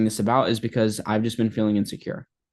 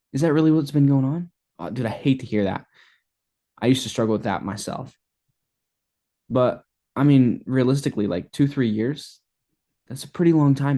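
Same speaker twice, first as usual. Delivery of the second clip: curious tone; 3 semitones higher than usual. Shown as a demonstration. Sales coach demonstrating the right curious tone to a prospect. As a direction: lean in and sound genuinely curious. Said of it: "be curious, have a tonality".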